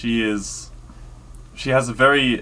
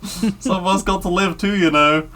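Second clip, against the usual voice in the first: much higher, louder mock voice; Monty Python quote; 11 semitones higher, 4 dB louder than usual. Telling a friend about the gift shop gag, high mock voice. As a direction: squeak it out like a cheeky sketch character.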